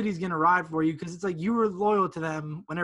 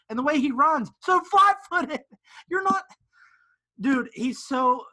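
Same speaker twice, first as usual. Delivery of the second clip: incredulous rant; 7 semitones higher, 5 dB louder, slower than usual. Real, not acted. Ranting about a bad player.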